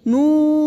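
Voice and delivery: نون with lips rounded, the u held long; slow round نون; rounded long noon. Shown as a demonstration. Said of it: "اس طرح پھر سے پڑھیں".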